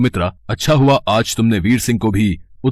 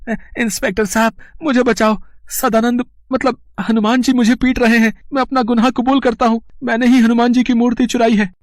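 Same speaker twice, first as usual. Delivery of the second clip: much higher, breathless panic to the inspector; frantic plea; 13 semitones higher than usual. Acted. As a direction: cry out for rescue in terror. Runs to police, being beaten.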